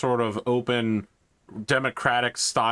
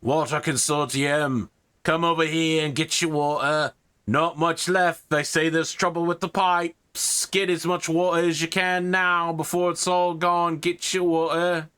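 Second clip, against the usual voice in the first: water seller; 7 semitones higher than usual. Acted. Water seller calling the crowd, higher hawker's cry. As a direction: hawk water to a crowd, a bit urgent. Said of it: "somebody else going".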